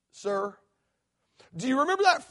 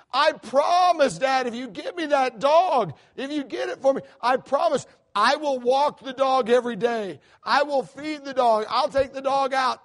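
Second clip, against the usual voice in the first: put-on higher, stretched-out begging kid voice; pleading daughter; 4 semitones higher, 3 dB louder than usual. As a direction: plead with Dad for a puppy.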